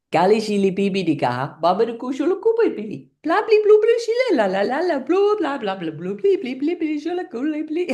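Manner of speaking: mock lawyer talk in pure gibberish; nonsense talk. Shown as a demonstration. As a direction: babble nonsense syllables as if earnestly explaining. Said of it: "So it goes like this".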